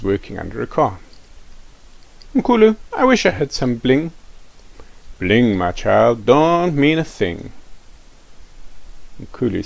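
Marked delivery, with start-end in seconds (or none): 2.3-4.1 put-on wishful boy. Rafiki to Mkulu. 5.2-7.5 put-on jazzy old man. Jazzy Mkulu replies.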